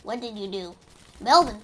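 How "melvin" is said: calling out loud. Pretend. Calling out to Melvin.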